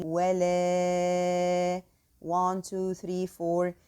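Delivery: ولا with the alif held for four counts; slow counted elongation; four-count prolonging. Shown as a demonstration. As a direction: stretch ولا slowly, counting the beats. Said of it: "should be four counts".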